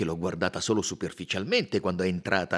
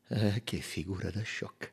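softer, tearful-sounding embarrassment; ashamed, hushed; 6 dB quieter than usual. A narrator's reading. Mary mortified after crying in front of everyone, hushed. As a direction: swallow the shame.

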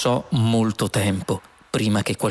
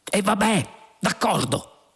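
high-pitched grudging agreement; exasperated concession; 10 semitones higher than usual. A narrator's reading. Lunqual concedes to the computer, exasperated. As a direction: give in with the pitch thrown up.

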